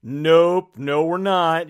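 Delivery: loud, teasing "nope"; the movie laughing at your guess; mock gotcha. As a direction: gotcha retort, playfully loud. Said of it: "make you think".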